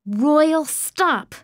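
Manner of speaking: high-pitched cry to stop; shouted plea. A narrator's reading. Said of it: "I shouted".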